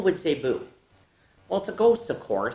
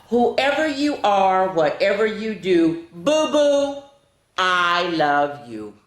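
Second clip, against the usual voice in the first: put-on reading to children; 3 semitones higher, 6 dB louder, slower than usual. Reading picture book to children.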